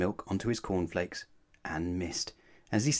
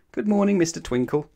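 higher, bright good morning; cheery greeting; 7 semitones higher, 4 dB louder than usual. Acted. Mister Wrong greets cheerily.

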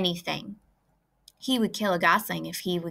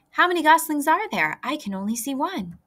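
eager question; 7 semitones higher, 3 dB louder than usual. A narrator's reading. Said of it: "shouted Wilbur".

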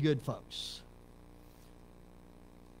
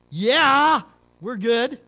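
imitating a cop; 6 semitones higher, 11 dB louder, much slower than usual. Police officer waving him on.

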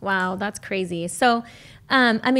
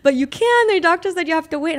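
husband through laugh-tears; 8 semitones higher, 5 dB louder than usual. Retold or imitated. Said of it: "And my husband's like".

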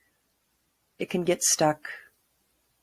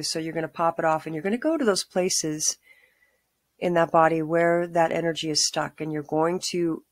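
calm grounding exercise. Acted. Guided grounding meditation.

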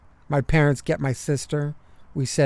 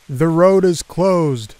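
loud, firm policeman warning; 3 semitones higher, 7 dB louder than usual. Acted. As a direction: call it out over the rain. Policeman warns stuck drivers.

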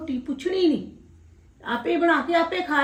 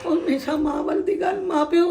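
weeping; 2 semitones higher than usual. Acted. Mother weeping about her sons.

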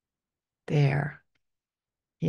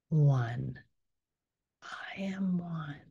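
slow whisper, much softer; 5 dB quieter, much slower than usual. Acted. Guide whispering oneness affirmation to the group, slow whisper. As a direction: let it fade into a hush.

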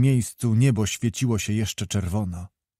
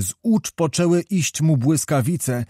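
plain reading voice

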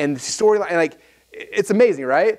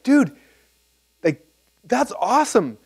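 excited praise; 8 semitones higher, slower than usual. Retold or imitated. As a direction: gush with sudden enthusiasm. Excited praise of Walter's skill.

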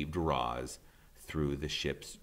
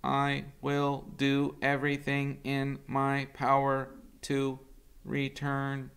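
tender robot mother; 10 semitones higher than usual. Acted. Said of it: "said Roz".

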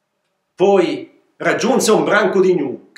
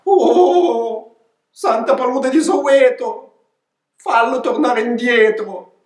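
another goat pleading; 9 semitones higher, 3 dB louder, slower than usual. Acted. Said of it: "aggiunse un'altra".

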